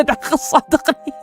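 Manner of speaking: high, breathy sleazy come-on; mock sleazy plea. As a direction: sneer it like a creep. Mocking the pervy friend's line. (تقليد متحرش بصوت ساخر)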